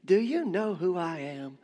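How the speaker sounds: slow, drawn-out mock outrage; mock indignation. Preacher acts out someone taking offense, mock indignant. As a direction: draw it out, grandly affronted. Acting out an offended person's retort.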